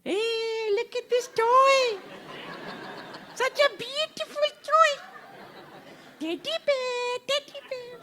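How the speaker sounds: very high sing-song falsetto; high-pitched toy-dangling coo; mother cooing to baby. Acted. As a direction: distract a crying child with squeaky baby talk. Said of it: "the mother comes and says".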